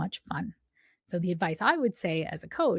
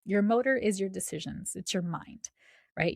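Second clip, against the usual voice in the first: ordinary talk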